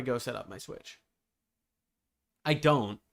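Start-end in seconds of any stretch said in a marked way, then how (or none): none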